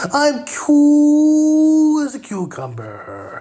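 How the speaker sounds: high, slow, drawn-out mock calm; sarcastic high slow parody. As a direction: stretch it up high like forced calm.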